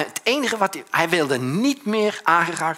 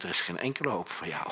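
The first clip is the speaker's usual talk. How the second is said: hopeless verdict; 8 semitones lower, 10 dB quieter, faster than usual. Retold or imitated. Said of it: "dan denk ik".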